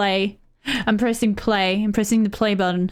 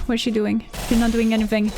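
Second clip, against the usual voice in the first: ordinary talk, nothing marked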